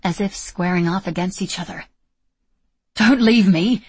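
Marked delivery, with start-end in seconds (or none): 2.9-3.8 loud, pleading call. Acted. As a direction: call after her, desperate. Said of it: "Julian called out after her".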